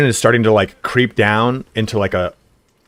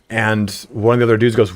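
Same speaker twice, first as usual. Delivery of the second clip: ordinary talk, nothing marked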